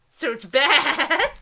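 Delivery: amused slow put-down; laughing. Real, not acted. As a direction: laugh through the verdict. Laughing verdict on book.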